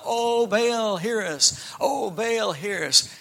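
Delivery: high, loud drawn-out chant to a god; calling out. A narrator's reading. Prophets dancing round the altar to Baal, calling out. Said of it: "call out".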